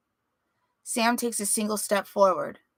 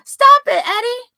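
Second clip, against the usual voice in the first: very high, loud shriek; child's scream; 12 dB louder than usual. Acted. Little brother screaming at Eddie, frightened shriek. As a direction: scream it like a terrified little boy. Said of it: "Billy screams".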